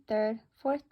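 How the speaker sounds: ordinary delivery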